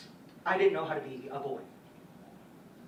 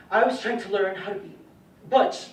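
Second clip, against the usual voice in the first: loud, raised pitch, hitting the last word; forceful admission; 4 semitones higher, 10 dB louder than usual. Acted. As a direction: punch it out emphatically. Names his mistaken goal.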